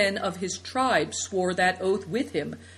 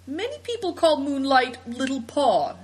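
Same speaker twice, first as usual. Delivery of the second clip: put-on boy character voice; 7 semitones higher, 4 dB louder than usual.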